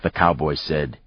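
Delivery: ordinary delivery, nothing marked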